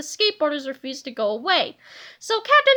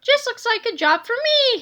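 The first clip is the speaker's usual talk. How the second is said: higher heroic call; pitched-up hero cry; heroic shout; 8 semitones higher than usual. A narrator's reading. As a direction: proclaim the rescue. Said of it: "he shouted".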